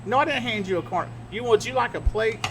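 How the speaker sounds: ordinary delivery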